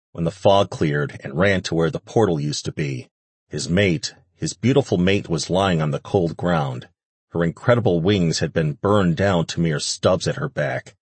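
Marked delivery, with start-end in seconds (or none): none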